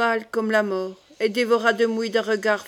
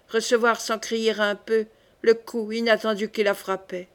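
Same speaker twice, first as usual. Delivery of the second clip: usual speaking voice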